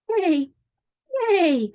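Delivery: high horse whinny; horse's neigh. Acted. Horse calls to the busy spider, high neighing. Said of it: "said the horse".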